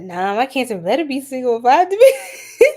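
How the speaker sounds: reads a viewer's joke through a laugh; giggling read of a chat comment; laughing while reading. Real, not acted.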